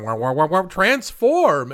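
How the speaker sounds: boings ending in a high, loud transform; silly transformation noise; mock sound effect. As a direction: mimic the weird boing sound. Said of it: "He's doing the weird boing".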